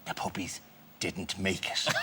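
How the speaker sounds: soft, grave, sombre delivery; solemn bad news. Breaking the puppy news to angry young fans, softly and gravely. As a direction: break the sad news gently. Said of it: "sit them down and go".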